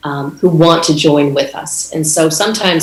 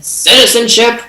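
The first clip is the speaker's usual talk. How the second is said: a hint of a gruff officer barking the word, pitched up; barked demand; 7 semitones higher than usual. Customs officer barking at a traveller, lightly imitated bark. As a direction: snap it out like a mildly imitated border guard. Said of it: "he barked and he said".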